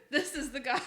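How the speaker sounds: words squeezed out through laughter; laughing. Real, not acted. Streamer losing it over a grumpy professor, laughing. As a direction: crack up while saying it. Cracking up at own story.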